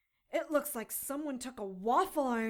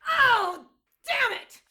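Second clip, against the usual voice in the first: much higher and louder; startled yelp; 14 semitones higher, 12 dB louder, slower than usual. Acted. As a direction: yelp as you dodge. Dodging prod.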